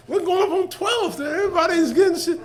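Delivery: put-on high, loud excited kid yelling; high excited holler; excited shout. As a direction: shout it out like an eager kid heading off. Retold excited kids yelling to mother.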